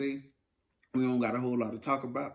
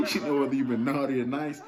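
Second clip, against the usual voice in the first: laughing through the words, louder; amused laughing; 3 semitones higher, 7 dB louder than usual. Real, not acted. Co-host runs with the Santa comparison, laughing. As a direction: crack up while playing along with the joke.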